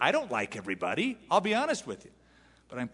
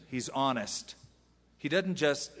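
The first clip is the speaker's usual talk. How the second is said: ordinary talk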